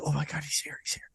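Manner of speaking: excited hushed whisper. Retold or imitated. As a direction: breathe it out in secret. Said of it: "They're like".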